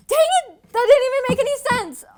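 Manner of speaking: exasperated, high and fast at herself; high-pitched frustrated groan at yourself; frustrated outburst. Real, not acted. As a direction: snap at your own flubbed line. Frustrated at own flubbed line.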